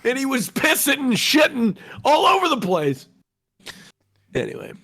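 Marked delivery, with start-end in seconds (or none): 0.0-3.1 excited shouting. Retold or imitated.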